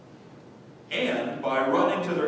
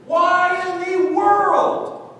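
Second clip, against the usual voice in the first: incredulous exclamation; 12 semitones higher, 7 dB louder, much slower than usual. Real, not acted. Preacher exclaims in disbelief.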